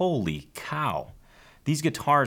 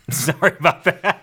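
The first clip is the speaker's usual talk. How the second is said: higher, louder words through laughter; laughing apology; 8 semitones higher, 6 dB louder than usual. Real, not acted. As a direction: apologize while cracking up. Laughing at his botched name.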